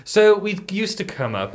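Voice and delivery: usual speaking voice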